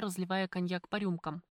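ordinary delivery

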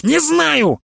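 high exasperated cry with stress on не; sudden outburst. A narrator's reading. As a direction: burst out, hitting не hard. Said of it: "вдруг воскликнул Прыгунов".

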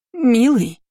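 high-pitched, startled exclamation falling away; startled gasp. A narrator's reading. Stepmother caught in bed by her husband, startled. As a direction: cry it out in alarm, pitch jumping up. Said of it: "ахнула мачеха".

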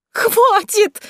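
very high, loud shriek; panicked high-pitched scream; yelling. A narrator's reading. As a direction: shriek to make it stop. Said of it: "завопила Василиса".

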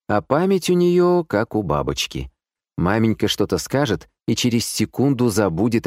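plain reading voice

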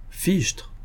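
soft, high gasp of admiration; admiring exclamation. A narrator's reading. Colonel hearing the brooch described, softly admiring. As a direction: marvel at it under your voice. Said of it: "fit le colonel avec admiration".

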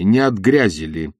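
usual speaking voice

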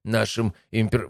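high-pitched, concerned guard commander; worried subordinate. A narrator's reading. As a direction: object anxiously but respectfully. Guard anxiously objects to emperor's plan.

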